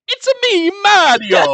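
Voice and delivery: high, loud fake Italian Mario voice; fake Italian accent. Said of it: "full fake Italian".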